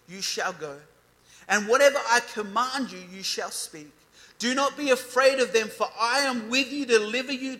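loud, preaching God's words over them; forceful scripture reading. Acted. Preacher to youth, reading God's command loudly. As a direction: proclaim it with full force. Reads God's charge to Jeremiah.

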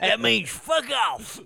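put-on belligerent drunk. Playing redneck homeowner.

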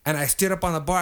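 ordinary talk

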